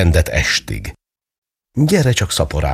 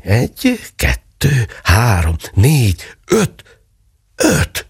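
rising higher with each number, peaking on the last; mother counts the pennies, thrilled; excited counting; 7 semitones higher, slower than usual. A narrator's reading. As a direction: count the coins with growing glee. Mother counting found coins.

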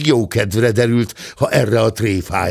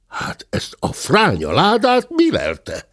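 higher, astonished grumble of the gentleman; puzzled wonder; 9 semitones higher than usual. A narrator's reading. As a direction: wonder aloud at the strange box. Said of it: "Csodálkozott a téns úr". (csodálkozó kérdés)

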